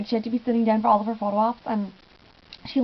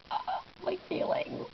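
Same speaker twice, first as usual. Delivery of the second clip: breathy swoon; 8 semitones higher, 11 dB quieter than usual. Retold or imitated. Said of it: "and I was just like".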